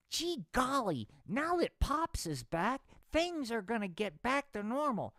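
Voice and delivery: put-on high, eager gee-whiz boy; squeaky naive kid voice. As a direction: chirp it like a wide-eyed kid.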